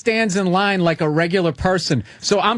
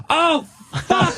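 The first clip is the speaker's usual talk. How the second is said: high-pitched shocked oh fuck; sudden realization, voice jumping up; dawning horror; 10 semitones higher than usual. Retold or imitated. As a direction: caught out, high and laughing.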